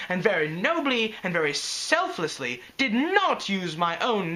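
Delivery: grand theatrical diva swearing revenge; vengeful Rarity. Acted. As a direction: ham up a dramatic vow of vengeance. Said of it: "She sounds like Trixie".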